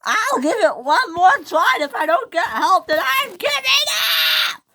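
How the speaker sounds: loud, high, climbing to a shriek; exasperated shout. A narrator's reading. Millie about to give up trying, exasperated shouting. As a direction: build from loud protest to shrieked finish.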